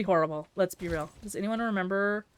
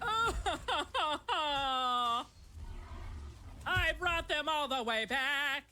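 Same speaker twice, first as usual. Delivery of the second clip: mock lament; 4 semitones higher, much slower than usual. Real, not acted. Lamenting lost carrots.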